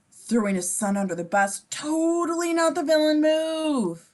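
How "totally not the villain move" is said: slow, higher, louder mock innocence; deadpan sarcasm, stretched and raised; sarcastic. Real, not acted. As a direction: drag it out with heavy irony. Sarcastic aside on the father.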